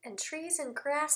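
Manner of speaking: whisper. Real, not acted. Teacher whispers picture observation.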